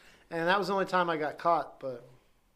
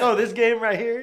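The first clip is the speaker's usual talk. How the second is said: raised, louder faux innocent voice; acting innocent; 6 semitones higher, 6 dB louder than usual. Caught shoplifting, playing innocent to the guard, raised and louder. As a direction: play it cool, fake innocence. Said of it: "just acted like".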